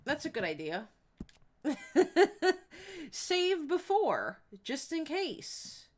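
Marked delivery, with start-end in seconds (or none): 1.6-3.1 a short bright burst of laughter; real laugh. Real, not acted. Streamer laughing at her own save-first plan, a short laugh. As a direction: laugh at your own cautious idea.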